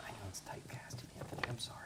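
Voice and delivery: soft whispered apology; whispered aside. Real, not acted.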